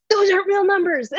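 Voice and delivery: high-pitched baffled protest; incredulous high voice. Retold or imitated. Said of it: "and you're like".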